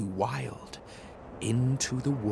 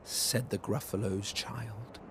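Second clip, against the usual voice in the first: ordinary delivery, nothing marked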